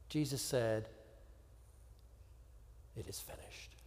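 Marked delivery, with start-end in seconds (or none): 3.0-3.8 a barely audible dying whisper; hushed, fading final whisper; whispered last words. Acted. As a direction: let the words go on a spent breath. Jesus's final words quoted.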